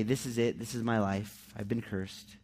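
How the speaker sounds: low, softening self-pity, lightly acted; a touch of woe-is-me resignation; sulking. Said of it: "sulk in it".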